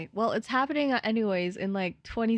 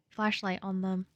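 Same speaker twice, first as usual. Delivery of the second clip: ordinary talk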